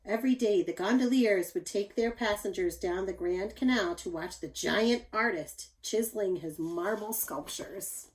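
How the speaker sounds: usual speaking voice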